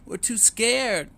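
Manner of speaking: put-on baby duck refusing in fear; scared duckling. A narrator's reading. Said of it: "cried Waddle".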